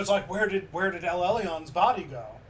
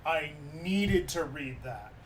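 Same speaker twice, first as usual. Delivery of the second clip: ordinary talk, nothing marked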